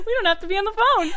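high, laughing words. Real, not acted. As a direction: speak through laughter. Laughing through the line.

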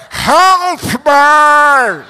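loud, drawn-out hammed-up cries for help; winded panic. Winded boxer begging for help from the canvas, mock-panicked wail. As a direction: wail slowly like a man sure he's dying. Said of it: "and I'm still going".

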